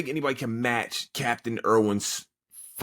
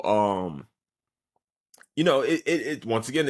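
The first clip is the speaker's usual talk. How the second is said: usual speaking voice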